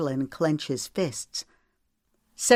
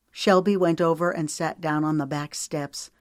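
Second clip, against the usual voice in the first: ordinary delivery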